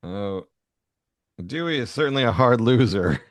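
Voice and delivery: ordinary talk, nothing marked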